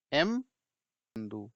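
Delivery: एम बंदू stretched out very slowly, word by word; Kannada pronunciation demo. Shown as a demonstration. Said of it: "तो पढ़ेंगे, देखिए".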